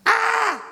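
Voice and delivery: short high, loud scream; startled shriek. Acted. Captive startled by her rescuer, short high shriek. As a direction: shriek as the blindfold comes off. Captive freed from blindfold cries out.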